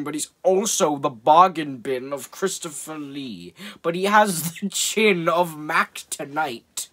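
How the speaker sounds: a hint of a grand villain's voice, slowed down; mild imitation of a cheap movie villain; Christopher Lee impression. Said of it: "And he talks like this".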